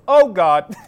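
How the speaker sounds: loud groaning outburst from a parent; exasperated parent. Retold or imitated. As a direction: imitate a parent's loud dismay. Parent in back reacts to trash talk.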